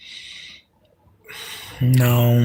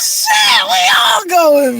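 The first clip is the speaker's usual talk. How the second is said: yelling it high through laughter; laughing so hard it turns to a shout; shrieking laughter; 4 dB louder, much slower than usual. Real, not acted. Joking to viewers about going to Mexico, then a high shrieking laughing apology.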